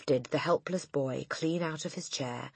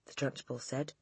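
plain reading voice

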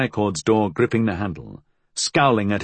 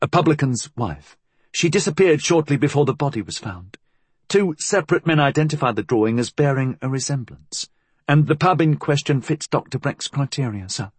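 higher-pitched, dutiful reporting voice; deferential inspector; 5 semitones higher than usual. Acted. Inspector reporting a lead to his superior, deferential.

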